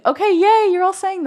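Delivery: high, loud burst of joy; delighted cheer. Real, not acted. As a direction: cheer happily at the viewers. Delighted reaction to viewers' comments.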